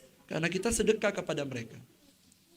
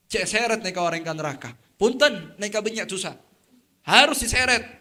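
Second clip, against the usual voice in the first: loud, insistent angel voice, rising to a shout; angels demanding; 3 semitones higher, 7 dB louder than usual. Acted. Angels loudly insist the sinner be dragged to hell.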